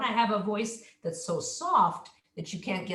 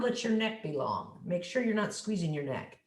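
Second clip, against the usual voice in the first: normal talking voice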